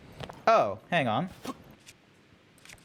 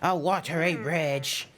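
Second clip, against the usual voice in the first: put-on weary old scholar, teeth stuck out; weary character voice. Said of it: "try and do a weary voice".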